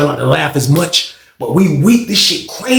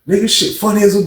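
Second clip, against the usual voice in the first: ordinary talk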